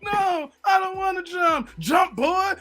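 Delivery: high, loud two-voice reenactment, yelling and pleading; movie rooftop scene. Retold or imitated. Host reenacting the movie's rooftop jump scene, shouting and whining. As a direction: play both sides, the shouter and the scared kid.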